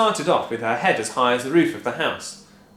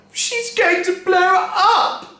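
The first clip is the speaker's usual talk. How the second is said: high, slow panicked wail; 8 dB louder, much slower than usual. A narrator's reading. Wife panicking as Grandma steams, high wail. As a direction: wail in alarm. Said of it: "Missus Cranky wailed".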